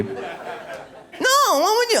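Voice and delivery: put-on high, slow insistent girlfriend voice; hammed-up pleading girlfriend; mock girlfriend whine. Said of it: "she's like".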